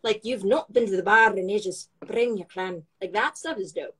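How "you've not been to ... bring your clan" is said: playful Scottish accent demo. Shown as a demonstration. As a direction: try out some fun Scots. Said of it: "I think Scottish is the fun".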